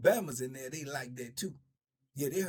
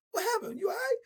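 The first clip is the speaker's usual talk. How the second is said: high, surprised check-in; narrator's retold startled question; 4 dB louder than usual. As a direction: sound alarmed and concerned. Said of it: "I go".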